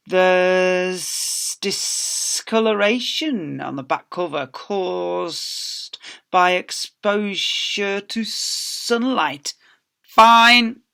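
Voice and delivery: slow, picky librarian building to a loud high FINE; a nasty old librarian; stern librarian. Acted. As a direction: drag out the faults, then bark the fine. Librarian inspecting returned books.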